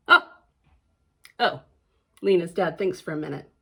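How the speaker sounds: ordinary delivery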